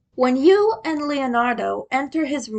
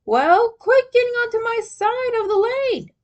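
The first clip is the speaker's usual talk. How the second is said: higher, lightly acted competitive yell; playful taunting shout; mock shout back; 12 semitones higher, 3 dB louder than usual. A narrator's reading. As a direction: Raph shouting back during the racing game. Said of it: "Raph shouted back".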